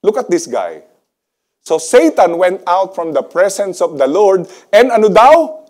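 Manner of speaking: ordinary delivery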